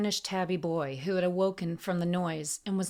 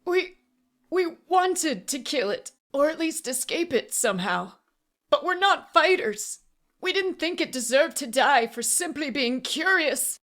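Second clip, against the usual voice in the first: high, loud, desperate defence; frightened pleading; 9 semitones higher, 6 dB louder than usual. A narrator's reading. Auctioneer defending himself to assassin, pleading. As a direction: stammer into a desperate plea. Cornered man pleads his case.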